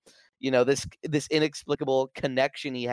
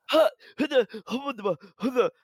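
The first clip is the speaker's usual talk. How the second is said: high, breathless gasps, a much higher voice; startled gasping; 10 semitones higher than usual. Retold or imitated. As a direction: gasp like he just saw something he can't place. Imitating Taki's startled gasps.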